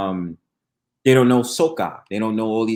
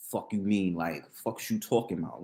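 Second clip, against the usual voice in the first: usual speaking voice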